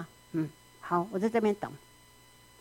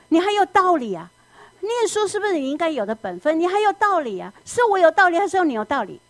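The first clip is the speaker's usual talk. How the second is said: indignant parent; 6 semitones higher than usual. Acted. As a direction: demand who is right. Parent scolding child. (父母大声讽刺地责问孩子)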